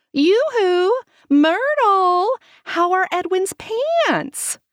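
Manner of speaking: high, loud sing-song call. Acted. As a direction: call across the street, teasing. Grandma calling across street.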